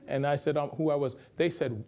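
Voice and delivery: ordinary delivery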